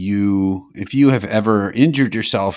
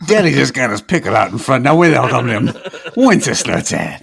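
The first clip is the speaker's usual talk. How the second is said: higher, louder swaggering character voice; Wenceslas voice; 4 semitones higher, 4 dB louder than usual. Pretend. Host does the Wenceslas voice for listeners, swaggering. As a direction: strut it like a cocky king. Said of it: "the person that does the Wenceslas voice".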